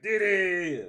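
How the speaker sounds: high loud mock shout of a chat message; yelling a name. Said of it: "It was like".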